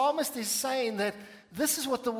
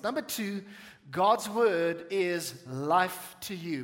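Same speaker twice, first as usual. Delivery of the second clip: ordinary talk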